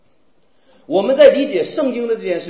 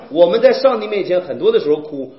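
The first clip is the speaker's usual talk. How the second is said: usual speaking voice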